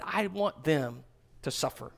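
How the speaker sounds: low, soft and bitter, almost under the breath; hushed hateful wish. Retold or imitated. Preacher voicing the listener's secret hate, softly. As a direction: let the ugly wish slip out quietly.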